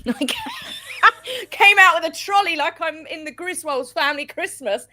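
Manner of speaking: high, loud laughing confession. Real, not acted. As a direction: tell it through a burst of laughter. Laughing at own shopping spree.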